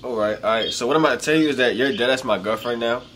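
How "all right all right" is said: ordinary talk, nothing marked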